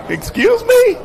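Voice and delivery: very high, startled squeak; stunned disbelief. Retold or imitated. Announcer handed the mic, squeaking in disbelief. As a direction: shoot the pitch way up in shock. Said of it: "And I'm like".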